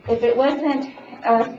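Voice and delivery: ordinary delivery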